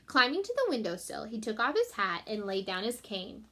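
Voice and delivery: ordinary delivery, nothing marked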